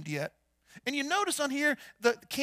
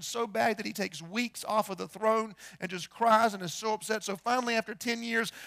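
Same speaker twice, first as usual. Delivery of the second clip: ordinary talk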